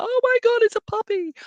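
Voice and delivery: high-pitched mock squeal of delight; acted, over-the-top puppy adoration; gushing excitement. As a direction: squeal as if you've spotted a puppy. Imagined gushing reaction to a puppy.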